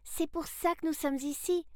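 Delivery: put-on high-pitched girl's call; calling back. A narrator's reading. Sarah answers the boy from afar, calling back high. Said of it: "cria Sarah en retour".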